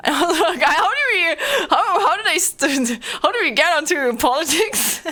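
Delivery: breaking into high laughter mid-question; giggly disbelief at the topic drift; laughing. Real, not acted. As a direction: laugh through the words, pitch up. Laughing at drifting onto politics.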